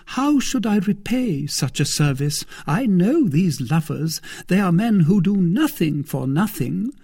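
high-pitched lady's voice, knowingly teasing; Milady, coy. A narrator's reading. Lady asking suitor his reward, high and coy. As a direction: coyly sound out the price of a favour.

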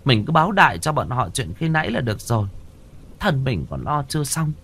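high, nagging woman's voice; exasperated wife voice. Acted. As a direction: talk him out of it, fed up.

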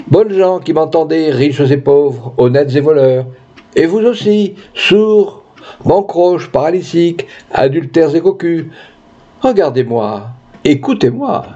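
grand oratorical call to all comers; candidate haranguing a crowd. Acted. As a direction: hail the villagers like a campaign orator.

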